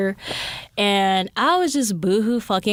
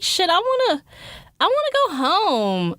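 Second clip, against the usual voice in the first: whiny homesick; 10 semitones higher than usual. Retold or imitated.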